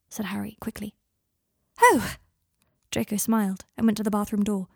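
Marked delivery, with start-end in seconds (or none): 1.8-2.2 high, loud relieved oh; delighted gasp. Acted. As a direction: burst with relief.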